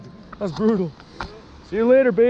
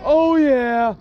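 usual speaking voice

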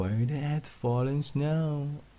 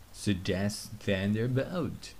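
ordinary delivery, nothing marked

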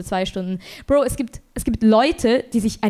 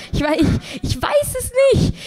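exasperated shout; 13 semitones higher than usual. Real, not acted. At a loss over how to treat the electrician, a high exasperated shout. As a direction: throw up your hands and yell it.

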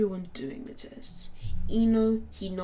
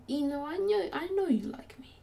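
higher, smug and teasing; cocky tease; 6 semitones higher than usual. Acted. Naruto smugly teasing Ino that he knows she likes him. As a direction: play it like you've caught her out.

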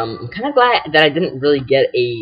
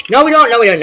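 high, louder repeated protest; mock refusal; 8 semitones higher, 5 dB louder than usual. Gamer refusing the game's plea to help, high mock protest. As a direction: blurt the refusal high and insistently.

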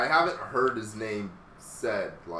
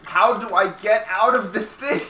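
frustrated yell; 10 semitones higher, 10 dB louder than usual. Real, not acted. Stuck in a hold, frustrated.

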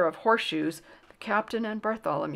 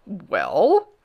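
loud, rising royal question; loud kingly WELL, swooping upward; insistent demand; 7 dB louder than usual. A narrator's reading. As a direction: press impatiently for an answer. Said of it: "demanded the king again".

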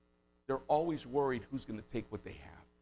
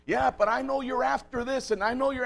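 much higher, louder mock grandma accusing; suspicious grandma; 11 semitones higher, 5 dB louder than usual. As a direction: play a paranoid old grandma snapping back.

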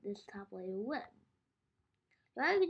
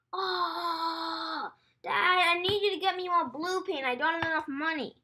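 high, loud whine to dad; whiny call; 6 semitones higher, 7 dB louder than usual. Real, not acted. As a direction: plead loudly across the room. Child calls dad for paint.

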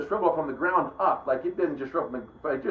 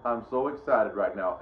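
lower, dull voice claiming excitement; deadpan excitement; 7 semitones lower than usual. Retold or imitated. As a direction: announce you're excited with none in it. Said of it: "he's not very exciting".

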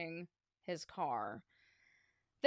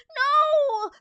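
high, loud desperate cry; 14 dB louder than usual. Acted. George begs the principal, desperate high cry. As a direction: scream it in panic. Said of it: "cried George".

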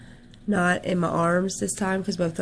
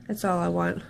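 usual speaking voice

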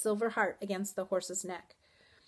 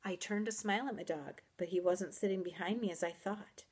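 ordinary delivery

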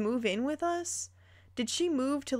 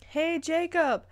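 raised, higher voice calling over club noise; yelling over music; 6 semitones higher than usual. A narrator's reading. Alexis shouting to Jacob over club music, mild raised call. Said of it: "I yell over the music".

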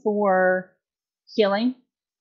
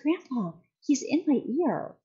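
put-on soft, small child's voice, much softer; hushed toddler quote; 4 semitones higher, 11 dB quieter than usual. Retelling her friend's toddler, a hushed little voice. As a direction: murmur it like a toddler confiding.

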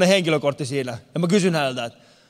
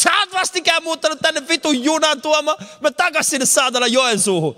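mock angry rant; 8 semitones higher than usual. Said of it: "Mä sanoin".

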